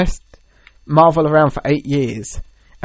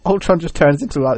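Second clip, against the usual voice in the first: ordinary delivery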